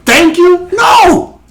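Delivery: high-pitched, incredulous preaching; emphatic rhetorical challenge. Real, not acted. Preacher challenging the congregation about God's commands, high and emphatic. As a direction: fire off questions, pitching way up.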